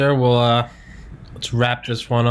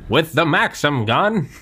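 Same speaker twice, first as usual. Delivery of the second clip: put-on old-timey voice; 7 semitones higher, slower than usual.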